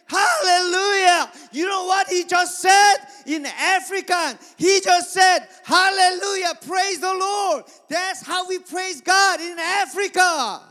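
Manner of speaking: loud, high, drawn-out acclamation; exultant preacher's shout. Retold or imitated. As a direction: imitate a missionary proclaiming praise at full voice. Acts missionary exclaiming from the pulpit.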